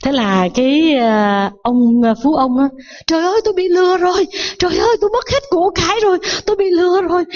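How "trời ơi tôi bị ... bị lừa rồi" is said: high, quick lament of a man just cheated; storyteller's acted distraught cry; mock wailing. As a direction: wail in hammed-up despair, pitched high and rushed. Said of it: "Bắt đầu khóc lóc quá trời".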